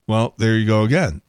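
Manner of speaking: ordinary delivery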